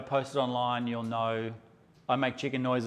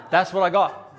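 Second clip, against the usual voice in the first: breathy laugh into a louder laughing line; 4 semitones higher, 4 dB louder than usual. Real, not acted. Photographer recalls the scary kid's glare, laughing loudly. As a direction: laugh it out, tickled.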